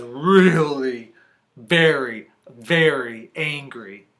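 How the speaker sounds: slow, weighty, drawn-out words; emphatic story narration. A narrator's reading. Reader tells children how angry he is, slow emphasis. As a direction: stretch each word to build the anger. Draws out the peddler's anger.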